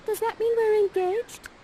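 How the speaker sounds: put-on very high excited girlish question; giddy Miranda. Miranda asking Ferdinand if they're engaged, high and giddy. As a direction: squeal it hopefully. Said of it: "Miranda's like".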